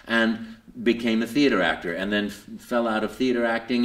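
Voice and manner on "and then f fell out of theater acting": ordinary talk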